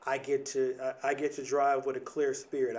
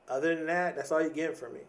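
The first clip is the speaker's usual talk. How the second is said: ordinary talk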